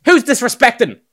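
retold angry yell, high and loud, easing down; mad teacher. Teacher confronts her class over artwork, mock furious. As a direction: imitate an outraged teacher calling out the class. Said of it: "she was really mad".

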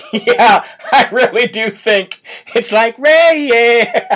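talking through laughter; laughing. Real, not acted.

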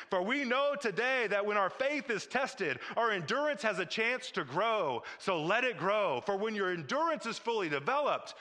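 rising, insistent preaching refrain; building sermon exhortation. Acted. Pastor urging a fearful congregation, building preaching. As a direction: press the refrain harder each time.

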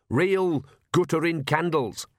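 plain reading voice

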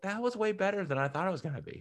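a hushed, rushed mock amazement; pleasantly surprised. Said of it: "I was like".

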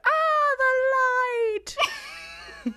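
imitated dramatic wail; mock agonized scream. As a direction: cry out as if blinded, hammed up. Imitating singer blinded by light.

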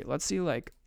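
normal talking voice

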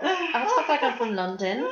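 put-on London accent, retold; English accent. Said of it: "I want an English accent".

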